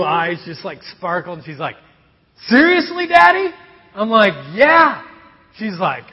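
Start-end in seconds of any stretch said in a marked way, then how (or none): 2.5-3.5 put-on high, loud thrilled child voice; excited little daughter. Daughter thrilled at the ice cream offer, high and loud. 4.5-5.0 eager yes. Retold or imitated. Dad answering daughter eagerly.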